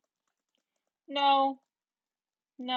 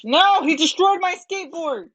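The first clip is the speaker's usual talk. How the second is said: scared and angry; 3 semitones higher, 7 dB louder than usual. Real, not acted. Skateboard destroyed by attacker.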